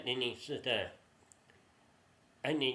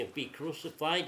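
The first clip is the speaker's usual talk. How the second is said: ordinary delivery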